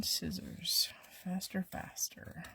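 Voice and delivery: urgent whisper. Real, not acted. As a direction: whisper it, urging on. Urges scissors in whisper.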